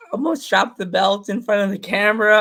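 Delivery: ordinary talk, nothing marked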